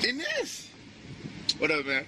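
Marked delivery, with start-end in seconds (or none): 0.1-0.7 high playful answer. Real, not acted. Joking answer.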